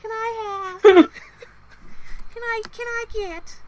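very high little voice, laughing; sweet timid order. Retold or imitated. Ordering at Chick-fil-A, high timid mock-sweet voice. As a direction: squeak out the order shyly.

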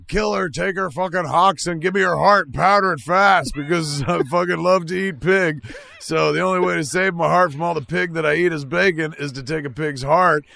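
loud, high, ranting order; greedy shout. Acted. Meat eater demanding a pig heart, loud high rant. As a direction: bellow it like a gluttonous tyrant.

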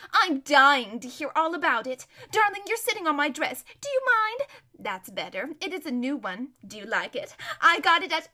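self-absorbed, excitable chatter; airy, swooping spoilt-lady voice; gushing society lady. Acted. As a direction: flit from gossip to your new dress.

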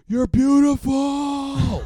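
high, slow hammed-up yell from the back row; mock drunken shout. Drunk fan in back row calling to the singer, hammed-up shout. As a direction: bellow it like a smitten fan.

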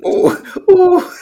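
high squeaky hooting; mock Pooh voice. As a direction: mimic the bear back, laughing. Joining the Pooh impression.

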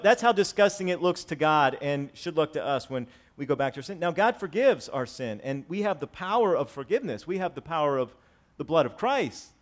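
ordinary talk, nothing marked

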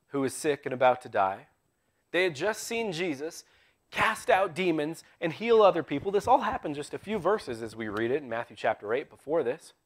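ordinary talk, nothing marked

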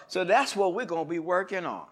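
normal talking voice

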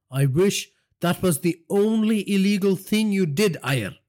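tied-up journalist defying the boss; defiant journalist. Acted. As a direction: throw it back at him. Journalist character voice.

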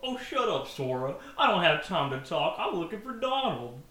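a hint of Goofy, brushing Sora off. Reading Goofy's line to Sora, a light Goofy voice. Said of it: "I'm Goofy".

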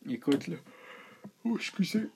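talking through a yawn; mid-yawn, words stretched open. Real, not acted. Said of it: "je baille".

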